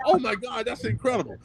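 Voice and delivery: imitating a friend's excited reaction; thrilled friend. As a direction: gush over the news. Friend reacts to big news.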